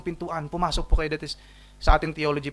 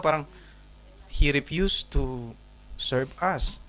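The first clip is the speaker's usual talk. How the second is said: ordinary delivery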